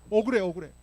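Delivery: mildly hammed-up wail of injustice; aggrieved outcry, lightly acted; acted grievance. Acts Joseph protesting his unjust jailing.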